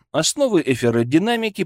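usual speaking voice

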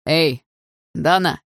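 приглушённый окрик издалека; окликнуть издали, без сильного крика. A narrator's reading. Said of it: "издалека прокричал Андрей". (лёгкий окрик вдаль)

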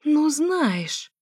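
high, outraged exclamation; indignant outburst. A narrator's reading. Narrator hearing her ex moan next door, high indignant outburst. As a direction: protest with rising indignation.

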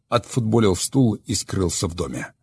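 usual speaking voice

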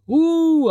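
very high, sustained "ooo" whoop; hooting yell. A narrator's reading. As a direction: hoot long and high like on a ride. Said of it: "орала Полина". (протяжное высокое улюлюканье)

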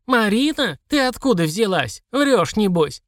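put-on cranky old codger doubting; old prospector. Old servant voiced.

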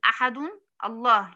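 أحد with a still "n", separate الله; wrong unjoined demo. Shown as a demonstration. Teacher ruling out this reading, wrong unjoined demo. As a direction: say "ahadun", stop, then "Allah". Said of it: "لا، ما ينفعش أقول".

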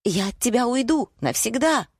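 high, squealed threat; shrieking attack. A narrator's reading. Wife threatening to leave her husband, shrieking. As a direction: lash back in a high squeal. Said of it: "взвизгнула и ринулась в атаку".

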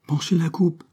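high, lightly exclaimed command; eager cry. A narrator's reading. Girl impatient for a sip from the cup, eager cry. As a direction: urge him on, pitched well up. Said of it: "s'écria-t-elle".

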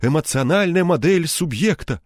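eagerly chiming in. A narrator's reading. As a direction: pick up the story with enthusiasm. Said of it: "подхватил Савелий Маркович".

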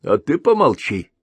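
high, loud scornful rebuke; sharp retort. Acted. Elder snapping at his lounging second self. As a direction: cut him down in scorn. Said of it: "обрезал его Большеголовый".

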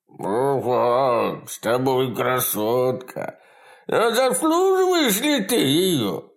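high, slow leering voice; lustful creature voice. A narrator's reading. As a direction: leer over every word. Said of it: "От похоти в голосе".

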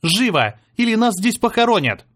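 high, loud urgent shout. A narrator's reading. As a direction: yell to hurry everyone. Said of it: "кричала Ева".

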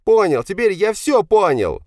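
slow, loud, very high-pitched yell; yelling back. A narrator's reading. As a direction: yell it bitterly back at father. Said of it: "заорал в ответ Павел". (заорал в ответ, высоко и протяжно)

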